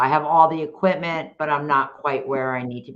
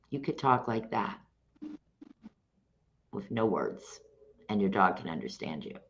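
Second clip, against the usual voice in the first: much softer, lower and gentle; soft low voice a dog trusts; soft calm voice; 7 semitones lower, 9 dB quieter than usual. Shown as a demonstration. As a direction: drop to a hush to show calm leadership. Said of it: "So you could speak like this".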